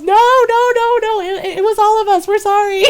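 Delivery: high, loud panicked pleading; panicked brother. Retold or imitated. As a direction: confess fast in a panic. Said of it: "one of my brothers goes".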